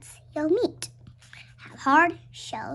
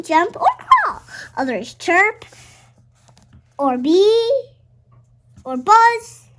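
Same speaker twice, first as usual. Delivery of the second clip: excited; 4 semitones higher, 9 dB louder than usual. Acted. Lively reading of insect actions.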